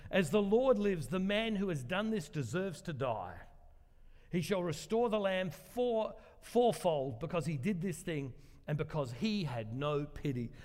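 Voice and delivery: usual speaking voice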